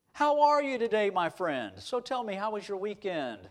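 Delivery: high, booming hello, easing into friendly questions; hearty greeting. Retold or imitated. Professor to a young man at the cafeteria, hearty and friendly. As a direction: welcome a stranger like an old pal. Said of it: "he would talk to you".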